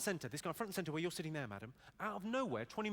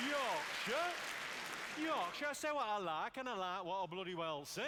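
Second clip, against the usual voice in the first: put-on Yorkshire accent. Comedian parodying proud Yorkshire folk, Yorkshire accent. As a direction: do an opinionated Yorkshire local. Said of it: "My favourite Yorkshire phrase".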